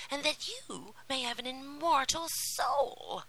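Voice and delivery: high, whispery menacing witch; a crooning sea witch, sly and cruel. Acted. Sea witch speaks to princess.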